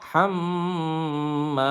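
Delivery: "হাম্মা" with a long nasal hum on the m; very slow chanted "হাম্মা", m and a both held; ghunnah. Shown as a demonstration. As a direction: linger on the doubled m of "হাম্মা". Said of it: "গুণা করে পড়বেন".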